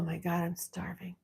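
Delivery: soft unvoiced whisper. Real, not acted. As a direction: whisper it as a private aside. Whispered aside.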